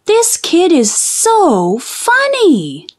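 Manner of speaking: high, slow, charmed adult voice; amused grown-up. Acted. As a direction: chuckle it to everyone around. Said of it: "They said".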